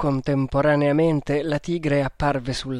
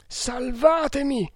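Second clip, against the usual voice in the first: stammered; 11 semitones higher, slower than usual. A narrator's reading. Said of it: "balbettò ancora una volta".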